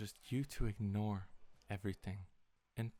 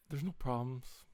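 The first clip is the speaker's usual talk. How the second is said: ordinary talk, nothing marked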